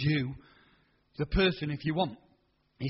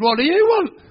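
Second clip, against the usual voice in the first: much higher, loud demanding call; brusque official; 11 semitones higher, 12 dB louder than usual. Retold or imitated. Race official challenging the old farmer, loud and high. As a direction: bark it like an official.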